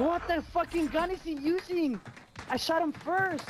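high outraged shout; furious disbelief, pitched high; raging gamer. Real, not acted. Angry at enemy gun.

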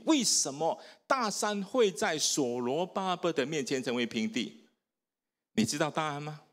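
ordinary talk, nothing marked

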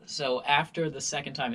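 usual speaking voice

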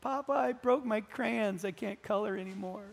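acted small, mournful child's plaint; tearful little girl. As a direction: whimper it like a heartbroken granddaughter. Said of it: "she goes like this".

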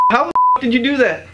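can't believe his eyes; amazed outburst. Acted. Stunned by the magic.

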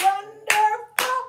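Wonderful very loud, high and slow, syllable by syllable; stretched, high chant of Wonderful; clapped syllables. Shown as a demonstration. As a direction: belt each syllable of Wonderful on its own beat. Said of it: "Clap it".

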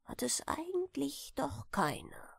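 unvoiced whisper, leaning in; whispered secret. A narrator's reading. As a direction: let it slip out in a whisper. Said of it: "whispered secret aside". (geheimnisvoll geflüstert)

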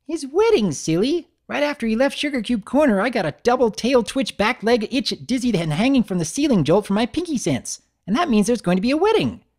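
high, quick and bright pony chatter; bubbly Pinkie voice. Acted. Pinkie hurrying off to bake, high and chirpy. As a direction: rattle it off high, already halfway out the door.

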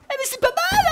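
squeaky high voice. Acted. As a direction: jump way up into a squeaky falsetto. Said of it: "Excusez-moi pour cette voix aiguë". (voix aiguë de fausset)

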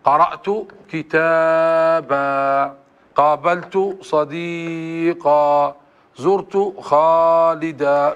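very slow, كتابا drawn out with a long final a; pausal alif stretched. Shown as a demonstration. Teacher exaggerates the pause form for the class, very slow. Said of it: "فتحة واحدة هكذا".

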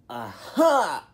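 ordinary delivery

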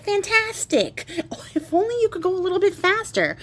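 hammed-up childish delight, stretched out; thrilled boy. Boy thrilled by the running bed.

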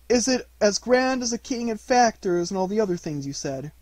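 hopeful, earnest questioning in a character voice; curious Pooh. Acted. Pooh asks how grand a knight is, curious bear voice.

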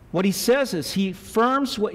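ordinary talk, nothing marked

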